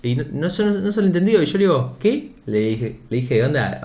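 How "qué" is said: high-pitched mocking "what?"; teasing, high questioning yelp. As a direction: toss it up high, winding him up. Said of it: "onda descansándolo".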